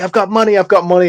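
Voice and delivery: hammed-up, urgent begging; imitated pleading. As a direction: voice a cornered man bargaining for his life. Voicing cornered Klan member pleading.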